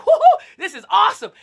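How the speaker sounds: laughing, loud gleeful woo-hoo; whooping with laughter; excited whoop. Retold or imitated. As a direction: cheer like a kid who just won. Gleeful quote on the stand.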